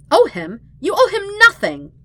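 loud high indignant shout; angry. Acted. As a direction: throw it back at him, outraged. Katara rejects Aang's debt angrily.

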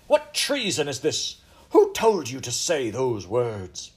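loud, slow, outraged royal demand; awful angry voice. A narrator's reading. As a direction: interrogate in fury, drawing out the words. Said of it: "she cried with an awful voice".

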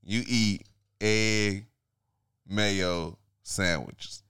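slow accusation. Real, not acted. Host slowly accuses guest.

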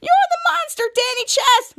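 very high, loud mock outrage; outraged accusation. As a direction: shriek the accusation, hammed-up. Acted accusation at villain.

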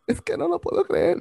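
mocking a teary dance-show winner; mock tearful joy. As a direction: squeak it like a winner crying. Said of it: "lloró de la emoción".

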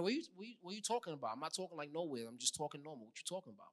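put-on casual, quiet New York accent; defensive mumble with a New York accent. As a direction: toss it off in offhand New York talk. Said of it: "Where did that accent come from?".

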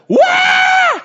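very high, loud thrilled scream; half-scared, half-delighted shriek; roller-coaster scream. Acted. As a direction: scream as if plunging downhill. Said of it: "you're going down".

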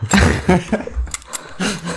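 squeaky high-pitched giggle; real laughter. Real, not acted. Laughing at croissant joke.

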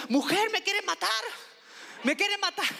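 imitating uncle screaming as he slips; mock panicked yell. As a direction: yell like a drunk slipping off a cliff. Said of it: "empezó a gritar".

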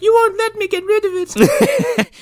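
very high, squealed complaint; hammed-up high-pitched protest; mock exasperation. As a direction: squeal it like a fed-up partner. Acting out exasperated woman in quarrel.

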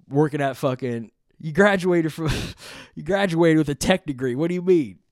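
hammed-up disbelief at a poser; mock calling-out. Acted quarrel, calling out friend.

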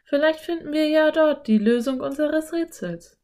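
put-on girl voice, keen to go in; eager Anne. A narrator's reading. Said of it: "schlug Anne vor".

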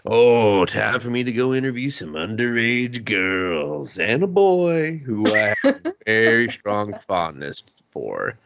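sleazy put-on voice, slow leering hum; creepy detective. Impersonating the creepy detective on his way to the girls, leering. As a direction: drawl the name like a creep. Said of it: "Detective Trashbag's like".

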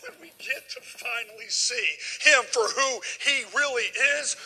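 usual speaking voice

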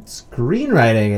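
ordinary talk

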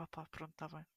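soft, resigned forgiving sigh. Retold or imitated. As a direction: give in quietly. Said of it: "Eu fico tipo".

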